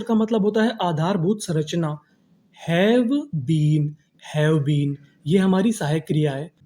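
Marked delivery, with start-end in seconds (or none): 2.6-3.9 word by word. Shown as a demonstration. Teacher introducing have been to students, slow and separated. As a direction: have been stretched, with a gap between.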